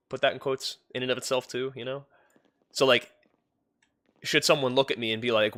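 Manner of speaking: ordinary talk, nothing marked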